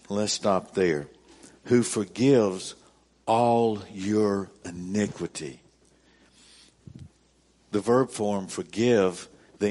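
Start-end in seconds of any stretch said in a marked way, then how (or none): 1.6-5.6 slower, pausing after "Who forgives"; slow, weighed reread. Acted. Pastor stopping to reread the verse for emphasis, slow with a pause. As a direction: break the verse in two and let it sink in.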